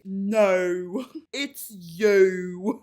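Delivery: loud mock-dramatic British accent; hammed-up British film accent; Harry Potter accent. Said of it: "the Harry Potter accent comes out of me".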